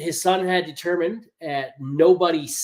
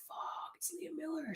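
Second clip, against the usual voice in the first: hushed, higher, drawn-out excitement; hushed starstruck reaction; 11 semitones higher, 21 dB quieter, slower than usual. Retold or imitated. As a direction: retell it as a stunned, very soft aside. Excited aside on spotting a celebrity.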